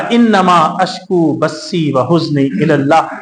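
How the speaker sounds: slow, low, measured recitation of the verse; Quranic recitation. Acted. Preacher reciting Yaqub's words to the congregation, slow and solemn. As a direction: intone it slowly like a recited verse.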